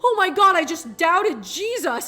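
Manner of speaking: put-on a kid horrified at his own thought; mock-horrified gasp of guilt; guilty panic. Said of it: "I would sit there and be like".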